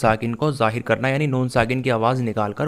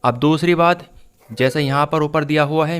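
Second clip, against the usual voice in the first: ordinary talk, nothing marked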